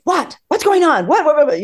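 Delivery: high, quick repeated whats; surprised pestering. Retold or imitated. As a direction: press the friend for an answer, voice up high. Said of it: "then she's like".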